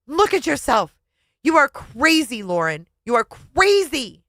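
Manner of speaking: high, loud shout, slowing down; husband shouting into the mirror. Acted. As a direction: hammer every word at her. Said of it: "he was shouting".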